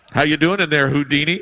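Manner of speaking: loud mock taunt, calling in; imitated brother's taunt. Older brother to boy locked in closet, loud mocking. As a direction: call loudly through a closed door, teasing.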